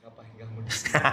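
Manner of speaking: hushed unvoiced whisper; whispered Holy Spirit voice. Preacher voicing the Holy Spirit to Dimas, whispered. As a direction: breathe it like a secret nudge. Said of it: "Di tempat parkir Roh Kudus bicara apa".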